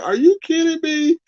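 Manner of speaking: high, stunned, half-laughing; can't believe what you're seeing; disbelief. Retold or imitated. Streamer reenacting shock at her kindness, high and laughing. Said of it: "This is how I was literally looking".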